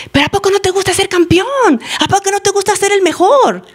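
high-pitched cajoling questions; coaxing master. Retold or imitated. Master flatters his champion to keep fighting, coaxing. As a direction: egg him on in a high, wheedling voice.

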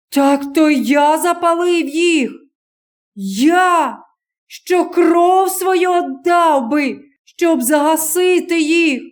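high, slow, straining cry of denial; accused stranger pleading with the villagers; desperate young man. Acted. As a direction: pour everything into the denial. Stranger desperately denies arson.

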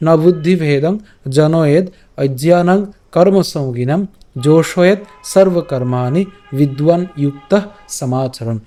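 slow, measured Sanskrit in short phrases; careful verse recitation. Shown as a demonstration. Teacher repeating the verse for viewers to practise, slow careful recitation. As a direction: repeat tricky phrases deliberately, syllables distinct. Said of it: "আমি আবার বলছি".

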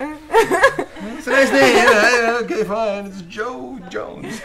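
high, loud, slow pleading; eager fan voice. Retold or imitated. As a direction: coax like a fan. Mimicking fans begging for names.

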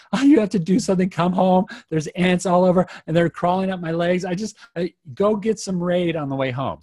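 high, loud, tearful pleading; imitating crying wife. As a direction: mimic his wife crying on the phone about ants. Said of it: "is crying, literally crying".

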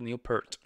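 "Peart" as one syllable, like "pert" with a final t; tentative name pronunciation. Shown as a demonstration. Reactor working out the drummer's surname, "Peart" said as "pert". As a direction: say "Peart" to rhyme with "hurt". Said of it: "I think how you pronounce it".

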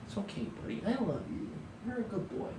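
hushed, soft pet talk; calm the cat gently; soothing the cat. Real, not acted. Teacher softly soothes his cat in his arms.

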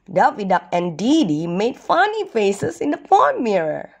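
ordinary delivery

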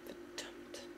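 a soft, breathless muttered curse. A narrator's reading. Arthas hearing the army approaches, muttering a curse. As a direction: swear under your breath. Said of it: "murmelte Arthas".

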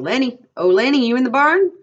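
high, loud searching call. A narrator's reading. George looking for Lenny in the barn, calling out. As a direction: call across a barn, rising at the end. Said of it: "a voice called".

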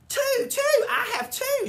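very high, loud triumphant holler; gloating holler. Acted. May bragging about her two eggs, loud holler. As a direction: crow it out to everyone. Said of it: "she hollered".

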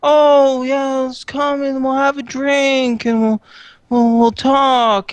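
high, loud mock-sweet invitation; mocking women. August mimicking women who tricked him, high and loud. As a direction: parody a syrupy invite. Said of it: "They say".

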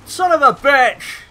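loud, high, drawn-out outburst; exasperated yell, stretched out; angry curse. Real, not acted. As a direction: curse out loud in real frustration. Cursing during the race.

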